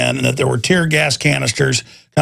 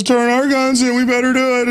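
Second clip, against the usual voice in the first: higher, sing-song mock compliance; mocking quote; 9 semitones higher than usual. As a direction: parody of meek obedience, much higher. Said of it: "the president of Mexico says".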